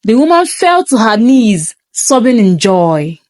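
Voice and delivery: ordinary delivery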